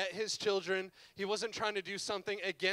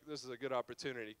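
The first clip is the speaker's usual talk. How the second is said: scheming aside; 9 semitones lower, 7 dB quieter, faster than usual. Retold or imitated. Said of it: "He's like".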